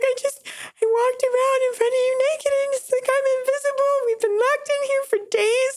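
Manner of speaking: very high, whining complaint; whiny complaint. Retold or imitated. Complaining to boyfriend in the hotel, high whine. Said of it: "I'm like".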